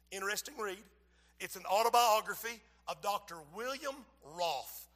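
ordinary delivery

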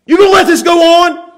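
loud, high outraged shout; in-your-face anger. Acted. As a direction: confront him with an indignant shout. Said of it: "somebody who's angry".

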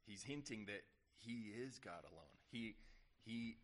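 usual speaking voice

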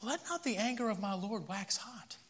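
calm Moses down gently; Aaron pleading. Acted. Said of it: "And Aaron said".

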